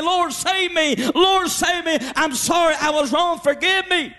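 high, mildly pleading prayer for mercy; desperate plea. Retold or imitated. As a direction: beg the Lord for forgiveness. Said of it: "try to begin to read and say".

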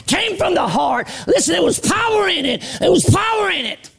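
high, shouted sermon call; preaching at full force. Acted. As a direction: preach it out to the church.

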